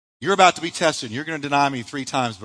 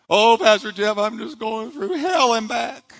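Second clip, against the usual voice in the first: whining churchgoer; 7 semitones higher, 4 dB louder, slower than usual. Retold or imitated. Mock complaining congregant.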